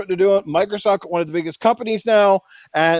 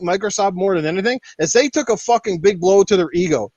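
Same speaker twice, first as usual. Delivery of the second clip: normal talking voice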